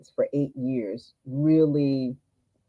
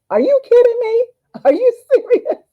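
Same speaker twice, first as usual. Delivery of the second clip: shocked disbelief; 11 dB louder than usual. Retold or imitated. Shocked reply to doctor.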